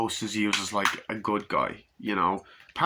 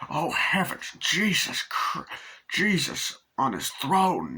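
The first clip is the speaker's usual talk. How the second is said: mock horrified old man; 7 semitones higher, slower than usual.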